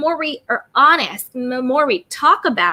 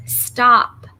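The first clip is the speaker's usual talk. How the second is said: ordinary delivery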